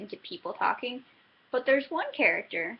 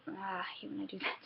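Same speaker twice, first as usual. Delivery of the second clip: a quick breathy whispered aside; frustrated whisper; 10 dB quieter, faster than usual. Real, not acted. Reviewer scolding herself mid-sentence, whispered. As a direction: whisper it to yourself, annoyed at the slip. Aside while losing her words.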